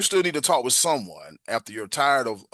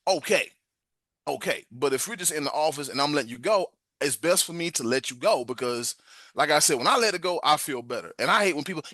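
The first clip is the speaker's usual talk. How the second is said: usual speaking voice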